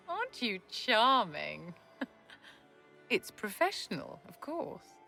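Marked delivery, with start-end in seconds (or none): 0.0-2.1 slow, higher, laughing lilt; playful drawn-out amusement; amused, flattered. Acted. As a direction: chuckle round the words, teasing. Amused reply to a personal question.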